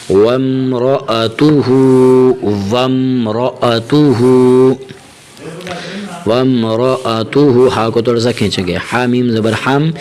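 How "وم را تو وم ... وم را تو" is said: slow, drawn-out recitation; Quran recitation. Shown as a demonstration. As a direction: stretch the ending slowly. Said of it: "recites slowly".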